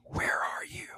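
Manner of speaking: hushed unvoiced whisper. Secretary whispering from the courtroom phone, hushed. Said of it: "She's whispering".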